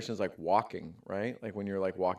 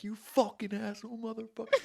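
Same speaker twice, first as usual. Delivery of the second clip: mock road rage; 11 semitones higher, 4 dB quieter than usual. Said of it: "Just going off on this dude".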